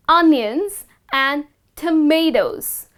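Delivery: onions as UN-yuns, tomatoes as to-MAY-toes; slow, careful onions and tomatoes; correct onions and tomatoes. Shown as a demonstration. Teacher modelling kitchen vegetables slowly. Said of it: "The correct pronunciation of these common veggies is".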